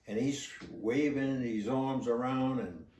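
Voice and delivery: usual speaking voice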